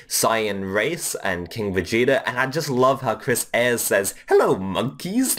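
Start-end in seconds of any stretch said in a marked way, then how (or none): 4.3-5.4 condescending Frieza. Retold or imitated. Imitating Frieza greeting the Saiyans, condescending. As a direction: look down on them as you greet them. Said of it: "so condescending and cunty".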